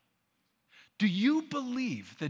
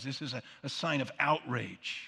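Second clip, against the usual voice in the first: usual speaking voice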